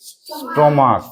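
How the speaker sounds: স্টোমাক। said on its own, ending in "k"; demonstrating pronunciation. Shown as a demonstration. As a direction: show the hard "k" sound closing স্টোমাক।. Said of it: "কিসের মতো উচ্চারণ হচ্ছে".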